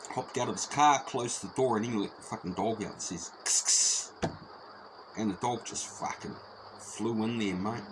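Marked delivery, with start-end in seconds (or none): 3.5-4.3 hissed kss kss to urge on a dog; whispered dog-urging hiss; sic'em hiss. Retold or imitated. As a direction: hiss to set a dog loose. Setting the dog on him.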